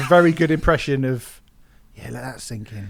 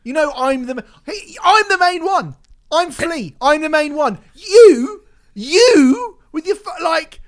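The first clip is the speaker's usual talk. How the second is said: imitating [name] ranting; 7 semitones higher than usual. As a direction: rant as an indignant bandmate. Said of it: "What if [name] go".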